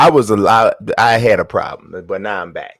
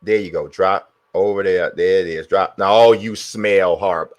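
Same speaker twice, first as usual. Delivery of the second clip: usual speaking voice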